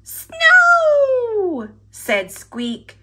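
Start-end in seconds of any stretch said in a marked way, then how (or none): 0.0-1.7 high, loud delighted cry of Snow!; a little mouse thrilled by snow; excited cry. Acted. Squeak to Pip, spotting snow, in a high loud delighted cry.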